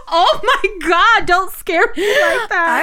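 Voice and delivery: high, loud, slow laughing; laughing hard, pitch up. Real, not acted. Laughing at the joke.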